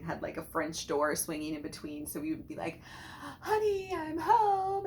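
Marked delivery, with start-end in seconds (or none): 3.4-4.9 high, loud sing-out homecoming call; playing busy mom. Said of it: "we played busy moms".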